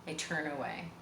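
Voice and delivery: usual speaking voice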